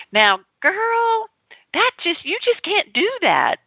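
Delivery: high-pitched Southern lady voice; high Southern drawl scolding the bride; Southern accent. Retold or imitated. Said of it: "Southern accent".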